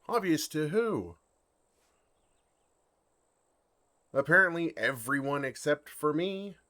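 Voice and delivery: loud, high, exasperated protest; indignant outburst. Acted. Dragon protesting to the woman, loud and exasperated. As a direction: throw it out in loud disbelief. Dragon learns everyone knew.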